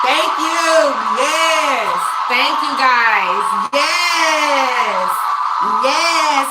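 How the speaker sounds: loud, excited yelled thanks; shouted thanks. Real, not acted. Streamer yelling thanks at her viewers, excited. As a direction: belt out the thank-yous.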